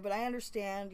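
ordinary talk, nothing marked

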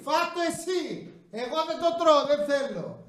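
high, soft, squeamish mock refusal; acting out refusing the worm snack, high and soft; mock disgusted refusal. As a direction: recoil in a high voice. His own refusal, acted out.

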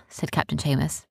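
ordinary delivery, nothing marked

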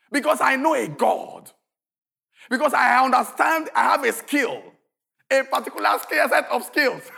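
loud, high preaching at full force; preaching shout. Acted. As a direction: thunder the promise to the congregation.

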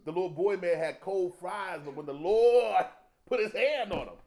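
slow, loud, rising preacher build; slow rising sermon swell; preaching build. Acted. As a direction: draw it out and climb to the Lord's hand.

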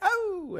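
very high squeal; girly gasp. Retold or imitated. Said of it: "And then when they're like".

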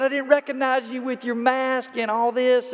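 light up at a familiar face; excited greeting. Retold or imitated. To the waiter, a former player recognized, excited greeting.

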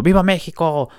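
mild mock yell. Guy in the crowd yelling at the fight, mild mock shout. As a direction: toss off the fan's cry as a light imitation. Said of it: "ahí gritando".

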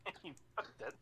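laughing through the words. Real, not acted. Laughs while joking about cooking.

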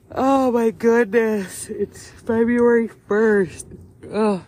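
loud, slow, drawn-out groan of disbelief; dismayed surprise. Real, not acted. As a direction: stretch it out, can't believe the date. Sudden realisation of the date.

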